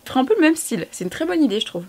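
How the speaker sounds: usual speaking voice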